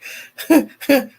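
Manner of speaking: high burst of laughter. Real, not acted. Host cracking up at her own title, high laugh.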